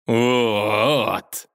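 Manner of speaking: loud grinning gloat; bare your teeth and gloat; gloating villain. Acted. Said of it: "осклабился Палкович". (злорадно)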